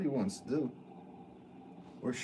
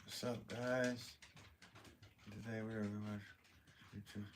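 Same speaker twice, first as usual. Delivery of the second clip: hushed, slowed-down imitation of a viewer; talking like the viewer; 15 dB quieter than usual. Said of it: "Can you talk the way I sound?".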